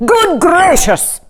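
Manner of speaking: high, slow, loud exclamation; sudden surprise. A narrator's reading. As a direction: leap up in shock. King springs up.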